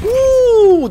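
high, loud victory whoop; excited whoop. Real, not acted. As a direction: let out a big triumphant woo. Celebrates kill.

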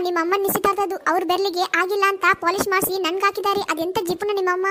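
usual speaking voice

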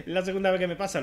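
high laugh into the words; laughing in disbelief. Real, not acted. Risa al repetirse el fallo. (riéndose con incredulidad)

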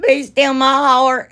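mock-faint with delight; swooning. Real, not acted.

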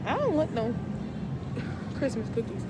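muffled behind cloth mask; speaking through fabric covering; through a face mask. Real, not acted. Seen 1: patterned cloth mask covers mouth and nose throughout clip.

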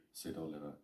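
plain reading voice

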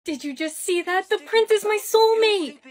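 high excitement building to loud; thrilled exclamation. Acted. Girl telling friend the prince is her soulmate, thrilled. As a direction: burst out with the thrilling news, pitched high.

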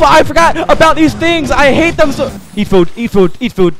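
terrified screaming at a biting creature; panicked screaming. Real, not acted. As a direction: scream it while the bat attacks you. Attacked by a bat creature.

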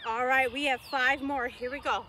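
ordinary delivery

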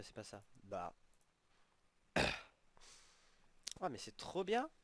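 ordinary delivery

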